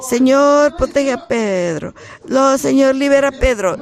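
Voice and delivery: loud, high pleading cry to the Lord; pleading prayer. Retold or imitated. Preacher voicing the church praying loudly for Pedro. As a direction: beg the Lord urgently to free him. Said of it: "No piensan, probablemente".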